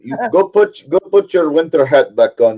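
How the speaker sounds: hammed-up Russian accent; mock Russian accent. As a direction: scold like a cartoon Russian.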